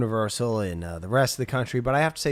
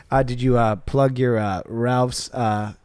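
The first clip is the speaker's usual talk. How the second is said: ordinary delivery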